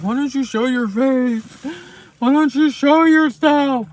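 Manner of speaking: loud, high mock demand; mocking demanding viewers. Imitating viewers who demand she show her face, loud mock complaint. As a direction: shout it like an annoyed viewer. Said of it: "I know people get mad".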